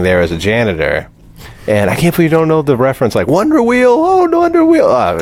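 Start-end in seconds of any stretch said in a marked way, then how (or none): 3.3-4.8 high, loud squeal of a movie quote; high-pitched mock cry; quoted movie line. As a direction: wail it high like the character. Quoting a movie catchphrase in disbelief.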